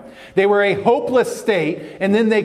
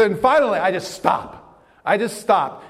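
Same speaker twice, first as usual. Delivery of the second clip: ordinary talk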